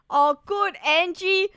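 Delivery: usual speaking voice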